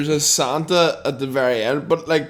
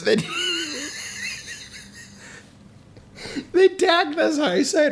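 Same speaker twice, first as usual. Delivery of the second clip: laughing; 13 semitones higher than usual. Real, not acted. Laughing while telling story.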